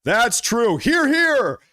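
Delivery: high-pitched, hammed-up chorus of agreement; mock cheering from the meeting floor; applauding meeting attendees. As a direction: imitate an approving crowd, pitched well up. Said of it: "everybody in the meeting is clapping".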